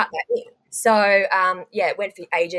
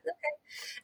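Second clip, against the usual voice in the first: meek okay; 9 semitones higher, 15 dB quieter than usual. Retold or imitated. Meekly complying with producers.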